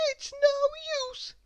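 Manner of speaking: very high, slow, sad sigh; sighing little girl. Acted. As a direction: give up hopelessly. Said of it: "she sighed".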